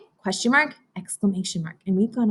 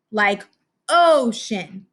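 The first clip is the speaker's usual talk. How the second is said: usual speaking voice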